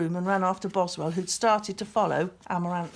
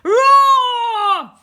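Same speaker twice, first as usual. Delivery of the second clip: huge high bellowing roar; louder beast roar; 16 dB louder than usual. A narrator's reading. The beast roars closer, booming and high. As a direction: roar it out at full power. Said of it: "louder and obviously nearer".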